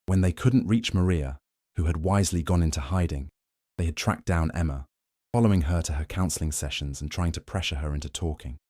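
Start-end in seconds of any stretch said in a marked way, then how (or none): none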